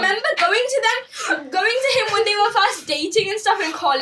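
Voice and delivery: high, laughing retelling; laughing while telling. Real, not acted. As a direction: giggle through the whole story.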